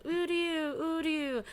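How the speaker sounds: put-on high, thin little kid's voice; small child calling. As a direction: call out like a tiny lost child. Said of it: "and he's just like".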